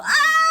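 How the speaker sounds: very high, loud panicked shriek; acted scream. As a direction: scream like a terrified passenger. Acts out screaming in the car.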